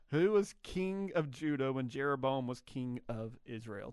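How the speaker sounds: slow down on the repeat so listeners catch it; slow reread. Shown as a demonstration. Host rereads the trivia question.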